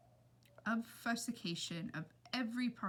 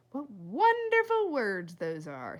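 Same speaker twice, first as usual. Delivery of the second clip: squirrel's delight; 6 dB louder, slower than usual. A narrator's reading. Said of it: "thought Ulysses".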